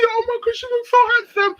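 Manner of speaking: high-pitched mock gushing; imitated high, excited fawning. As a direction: squeal it like a swooning admirer. Gushing over brother in retold meeting.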